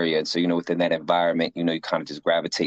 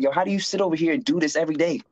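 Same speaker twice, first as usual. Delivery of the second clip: a lightly voiced curious fellow inmate; curious inmate friend; 8 semitones higher, faster than usual. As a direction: voice pitched well up, asking eagerly. Friend voiced in a prison story.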